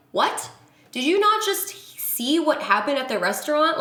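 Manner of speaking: can't believe he asked; incredulous retort. Retold or imitated. Replying to his jacuzzi invite.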